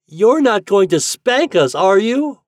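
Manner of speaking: high, loud pretend wailing; mock wail. A narrator's reading. Fat pretends to fear a spanking, mock wailing. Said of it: "wailed Fat, making believe to cry".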